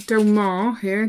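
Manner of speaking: usual speaking voice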